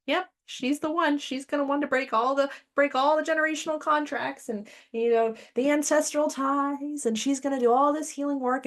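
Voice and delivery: a hint of eager spirit elders; ancestors voiced. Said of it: "my ancestors were like".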